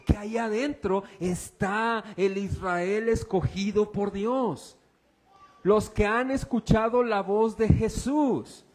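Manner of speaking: high, loud, driving pulpit preaching; fervent preacher calling out to the church; preaching at full force. Acted. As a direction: proclaim it to the congregation. Opening of the sermon.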